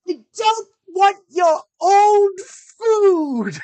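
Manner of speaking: put-on high, slow, drawn-out kid's shout; bratty yell. Daughter rejecting her father's petrified orange, shouting. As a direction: yell it like a disgusted child.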